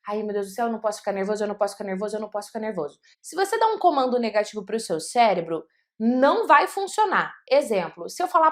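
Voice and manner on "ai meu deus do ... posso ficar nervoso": quiet, worried muttering to yourself; softly fret, repeating the worry over and over; anxious self-talk. Retold or imitated. Speaker frets before a talk, quiet and anxious.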